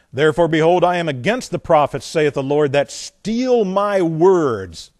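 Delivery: loud declamation, drawing out the last phrase; forceful scripture reading. Acted. Preacher reads God against word-stealing prophets, forceful scripture reading. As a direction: thunder the verse at the congregation.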